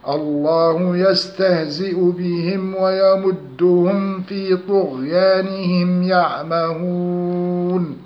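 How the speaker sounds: slow Quranic chant, the m of بهم and ويمدهم sounded clearly without a hum; tajweed clear meem recitation. Shown as a demonstration. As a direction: recite in sustained tones, distinct meem in بهم, very long ending on يعمهون. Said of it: "وينبغي مراعاة تحقيق الإظهار".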